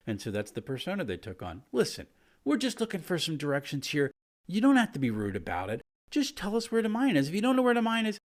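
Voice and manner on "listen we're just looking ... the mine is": higher, insistent and annoyed; pushy angry kobold. Acted. Kobold pushing the players for directions, pushy and annoyed. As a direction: demand the way like an aggressive tourist.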